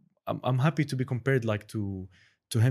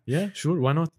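mock eager agreement; 7 semitones higher, 4 dB louder than usual. Joking along, high and loud.

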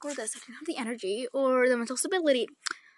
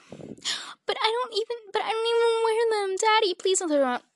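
put-on high, louder kid-to-parent begging; mock pleading; 10 semitones higher, 4 dB louder than usual. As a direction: plead with Daddy, pitched way up. Acts out pleading with dad.

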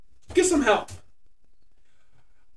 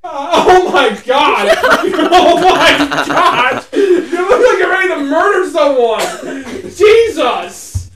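loud, high, drawn-out cries through laughter; real hysterical laughing; 7 semitones higher, 6 dB louder, much slower than usual. Real, not acted. Host reacting to friend's murderous look, laughing helplessly. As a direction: shriek it while cracking up.

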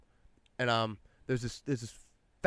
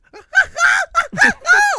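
loud, high, explosive cackle impression; imitated friend's laugh; 13 dB louder than usual. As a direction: burst into your friend's huge laugh. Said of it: "hearing him just".

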